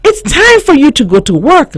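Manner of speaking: high, loud cheerful teacher; rally the letters to work. A narrator's reading. Capital T addresses letters.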